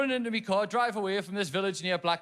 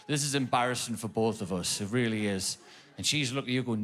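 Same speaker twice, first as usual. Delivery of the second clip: ordinary talk, nothing marked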